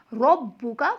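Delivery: "रब्बुका" opening on a full, round "रॉ"; thick rounded R. Shown as a demonstration. Teacher shows the correct reading, "रब्बुका" with heavy round र. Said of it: "यानी कि मोटा".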